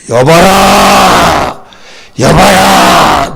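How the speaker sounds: drawn-out lordly call at the gate; commanding shout. Acted. General calling out at Elisha's door, slow commanding shout. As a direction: bellow it slowly like a proud general.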